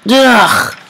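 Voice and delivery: high loud ugh; exasperated yell; loud frustrated groan. Real, not acted. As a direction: groan out real annoyance. Must redo level.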